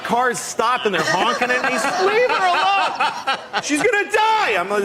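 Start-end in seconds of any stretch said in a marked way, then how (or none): 2.1-4.5 very high, drawn-out outcry; mock bystander protest, high and slow; horrified bystanders. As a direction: wail it like a horrified onlooker. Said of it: "people on the sidewalk just horrified".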